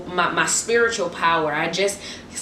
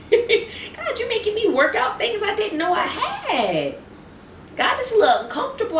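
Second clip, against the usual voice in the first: much higher, giggling as she talks to God; laughing prayer; 14 semitones higher than usual. Real, not acted. Preacher jokingly praying about sore muscles, high laughing voice. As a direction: complain to God through laughter.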